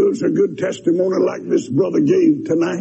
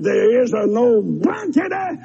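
shouting his defiance, slowing down; mock defiant yell; 7 semitones higher, slower than usual. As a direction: hurl it at the sky, drawn out. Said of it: "and I said".

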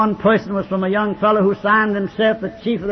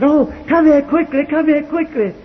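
higher, urgent call of a nurse; excited nurse; 7 semitones higher than usual. Retold or imitated. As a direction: hurry him over, voice lifted. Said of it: "She came running in excitement".

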